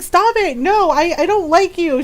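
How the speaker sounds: high-pitched imitated objection; mock flustered protest. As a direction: play a teased girl pushing back. Teased girl protests.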